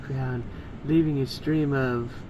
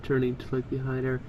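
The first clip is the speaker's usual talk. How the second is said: usual speaking voice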